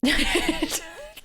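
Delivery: squealing burst of giggles; high laugh. Real, not acted. Streamer cracking up at the running joke, squealing laugh.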